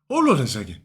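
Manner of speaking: the old math teacher stops short on recognizing his student, lightly voiced; imitating Carlos Esposito. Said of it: "he sounds just like, uh, Carlos, uh, Esposito".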